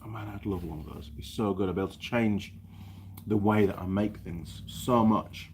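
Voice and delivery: ordinary delivery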